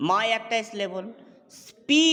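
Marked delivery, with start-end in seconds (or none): 1.6-2.1 "স্পি" alone, loud and higher; isolated syllable. Shown as a demonstration. Teacher splitting a word for students, "স্পি" said as a separate syllable. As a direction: sound out "স্পি" as one separate, raised syllable. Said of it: "স্পি এবং রিট দুটো syllable".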